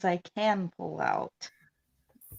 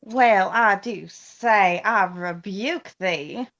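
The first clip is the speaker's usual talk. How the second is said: slow, drawn-out Southern belle; Southern belle accent; 2 semitones lower, much slower than usual. Shown as a demonstration. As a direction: draw it out like a genteel Southern belle. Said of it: "you do a Southern belle".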